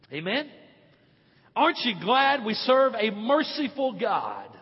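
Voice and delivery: usual speaking voice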